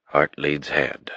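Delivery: ordinary delivery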